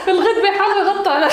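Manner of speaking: high, helpless laughing echo; hysterical laughing mimicry. Mocking co-actor's fluffed line, laughing hard. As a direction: repeat the blooper while cracking up.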